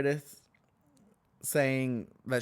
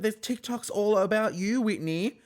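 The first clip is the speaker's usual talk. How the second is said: loud, high taunt; 11 semitones higher, 4 dB louder than usual. Retold or imitated. Lisa taunting Whitney, loud and high. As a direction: throw it at Whitney. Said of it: "And she's like".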